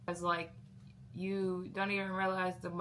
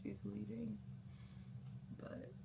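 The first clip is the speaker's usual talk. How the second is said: sad little whisper; 11 dB quieter than usual. Real, not acted. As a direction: breathe it out, unvoiced and sad. Mom leaving, sad aside.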